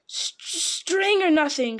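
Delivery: high, slow creature shriek. A narrator's reading. Gollum making his last guess, shrieking. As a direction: start on a hiss, rise into a shriek. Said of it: "shrieked Gollum".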